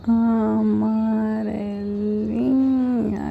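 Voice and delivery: very slow "Amarelinha", every syllable drawn out as it is written; slow dictation of "Amarelinha" syllable by syllable. Shown as a demonstration. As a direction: write along while dragging "Amarelinha" out. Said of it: "Vou apagar".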